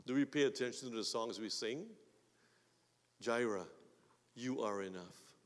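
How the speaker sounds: usual speaking voice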